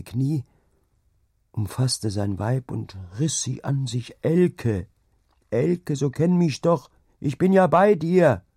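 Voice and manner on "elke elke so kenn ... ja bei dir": urgent, raised-pitch plea, building louder; desperate pleading. A narrator's reading. Husband calling his delirious wife back to him, pleading. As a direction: plead high and slow, swelling to a cry.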